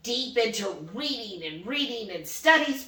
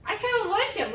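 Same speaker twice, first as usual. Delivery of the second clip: much higher grudging voice; imitating husband; 11 semitones higher than usual. Wife imitating husband's grudging praise, much higher. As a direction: admit it reluctantly, mock tone.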